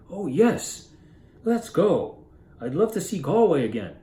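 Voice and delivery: light up with eager delight; excited. Retold or imitated. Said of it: "his wife gets really excited".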